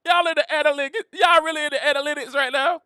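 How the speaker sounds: high, teasing repeated questions; mocking disbelief. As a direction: poke fun at them going into analytics. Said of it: "I'm like".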